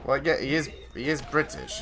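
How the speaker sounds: ordinary talk, nothing marked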